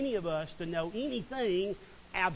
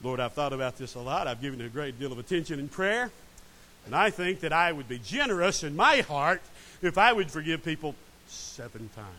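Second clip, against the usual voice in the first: lower, self-important voice for Peter, pleased with himself; Peter, self-satisfied; 4 semitones lower, 4 dB louder than usual. Retold or imitated. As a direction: play Peter fishing for praise. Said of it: "Peter thinks he is being generous".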